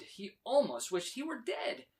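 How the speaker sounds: ordinary delivery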